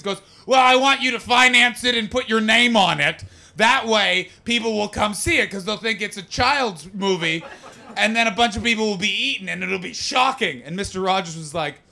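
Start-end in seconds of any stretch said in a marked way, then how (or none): 0.5-10.6 loud, emphatic director caricature; forceful, chewed-out sales pitch; George A. Romero's biting voice. Acted. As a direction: pitch the film as if biting the listener. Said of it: "like he's trying to bite you".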